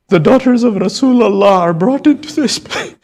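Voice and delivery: high, loud, sobbing wail; real anguish pouring out; wailing cry. Real, not acted. Preacher wails in grief.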